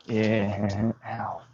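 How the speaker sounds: pained, quiet and aching; sore muscles. Real, not acted. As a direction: groan through the soreness. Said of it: "Everything hurts all the time".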